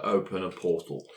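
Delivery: ordinary delivery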